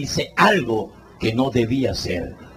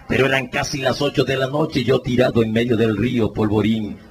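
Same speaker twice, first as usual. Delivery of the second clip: ordinary talk, nothing marked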